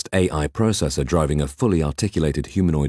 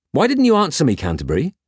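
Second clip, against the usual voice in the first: higher, teasing question; suppressing a smile; 10 semitones higher than usual. Acted. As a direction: ask innocently, hiding a smile. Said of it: "barely concealing a smile".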